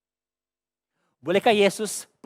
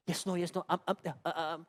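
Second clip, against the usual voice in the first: soft, halting stammer; 9 dB quieter than usual. Acted. Preacher acting out his struggle preaching in English, softly stammering. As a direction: stumble quietly through broken fillers. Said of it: "Sudahlah, gagap".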